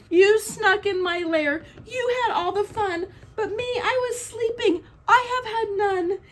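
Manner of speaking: high, blubbering mock cry; hammed-up tearful complaint; whimpering bear. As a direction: wail it like a sulky storybook bear. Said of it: "And he whimpers".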